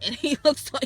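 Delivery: ordinary delivery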